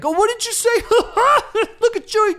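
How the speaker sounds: very high squealed mimicry with laughing; mocking laughter. Listeners mocking a foreigner's mistake, shrill mock amusement. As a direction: squeal it like a gawker making fun.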